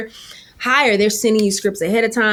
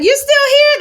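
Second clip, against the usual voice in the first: mock surprised castmate; 6 dB louder than usual. Castmates' mocking question.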